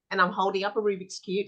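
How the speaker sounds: usual speaking voice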